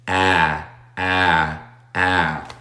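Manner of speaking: a said as a sound between A and E; vowel between a and e. Shown as a demonstration. Teacher demonstrates the vowel sound of apple, repeated. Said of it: "una combinación entre la A y la E".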